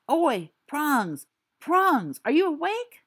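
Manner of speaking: high, pleading wake-up call; urgent whisper-call. Acted. Sirius tries to rouse sleeping James, high and urgent. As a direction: pitch it up, desperate to wake him.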